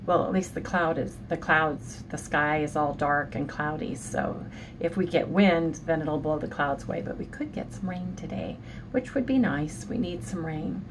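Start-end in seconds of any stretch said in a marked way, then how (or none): none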